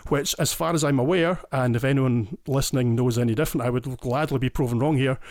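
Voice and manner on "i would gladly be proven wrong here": usual speaking voice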